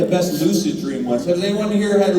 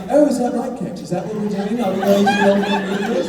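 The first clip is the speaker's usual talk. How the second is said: higher-pitched, teasing British impression; mock British accent; 4 semitones higher, 3 dB louder than usual. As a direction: imitate a co-star's jokey British accent. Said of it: "a British accent back to me".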